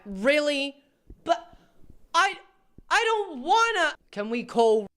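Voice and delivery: high, slow whiny pleading; whiny man child. Acted. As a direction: whine like a sulky kid. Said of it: "stop trying to be funny".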